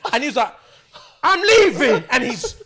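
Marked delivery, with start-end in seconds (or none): 1.2-2.0 high, loud imitation of a stressed friend; mock tearful outburst. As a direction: cry it out, half laughing. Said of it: "he's crying and he's stressed".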